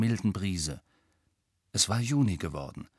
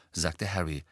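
usual speaking voice

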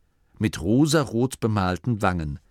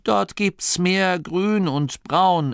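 firm reply, slow and higher; categorical; 9 semitones higher, 3 dB louder, slower than usual. A narrator's reading. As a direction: state it slowly and firmly. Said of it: "erwiderte Masklin kategorisch". (langsam und kategorisch)

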